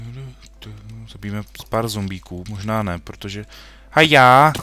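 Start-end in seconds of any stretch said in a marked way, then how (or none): 3.9-4.5 loud, high fighting yell; battle cry. Real, not acted. Gamer attacking zombies with a loud battle cry. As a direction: charge in with a yell.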